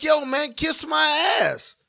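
shouted dismissal. Real, not acted. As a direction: yell it out slowly. Shouting at disagreeing women.

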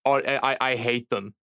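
ordinary delivery

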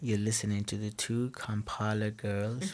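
low, hushed late-night radio voice; slow, soft, deep radio-host sign-off; podcast voice. Shown as a demonstration. Guest copies the hosts' sign-off, podcast voice. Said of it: "you've got the whole podcast voice".

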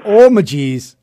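slow, drawn-out Minnesota oh my geez; Midwestern exclamation. Shown as a demonstration. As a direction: savour it like a true Minnesotan. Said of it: "beautifully Midwestern exclamation".

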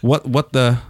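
lightly done American accent demo; casual American accent, a touch understated. Shown as a demonstration. British comedian showing podcast hosts his American accent. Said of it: "before I do this American accent".

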